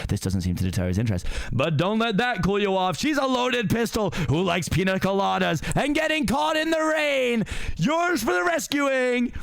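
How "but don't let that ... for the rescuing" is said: high, slow showman announcing the prizes; game-show host voice. Acted. Magic mirror presenting bachelorettes to Farquaad, high slow host voice. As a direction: drawl it out like a dating-show host.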